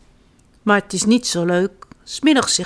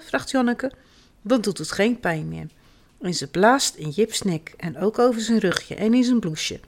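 usual speaking voice

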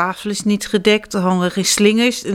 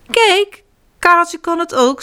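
much higher, bright show-off voice; proud little elephant; 9 semitones higher, 4 dB louder than usual. Acted. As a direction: boast like a small child. (trots klein olifantje)

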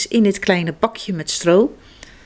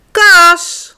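loud call up the stairs; papa calling Kas to bed, loud call; 9 semitones higher, 10 dB louder than usual. Acted. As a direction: call the boy to bed from another room. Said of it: "roept papa".

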